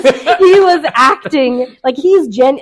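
normal talking voice

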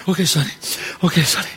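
put-on nervous kid. The kid answers the gambler.

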